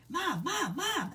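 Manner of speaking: put-on high, rising child's cry of Mom; scared child calling. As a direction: call out like a frightened little girl. Four-year-old calling mom at night.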